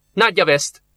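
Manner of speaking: usual speaking voice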